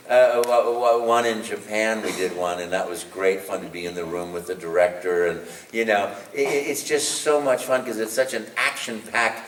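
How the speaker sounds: ordinary delivery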